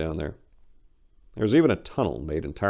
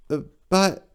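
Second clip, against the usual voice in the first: high stammering bird voice; 8 semitones higher, slower than usual. Acted. Big bird stammering as she is sent out. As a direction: stumble over the words, lost. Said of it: "the big bird stammered".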